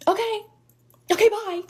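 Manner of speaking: high, sing-song fake-polite brush-off; dismissive send-off. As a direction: act out getting rid of someone. Acted curt send-off to rude customer.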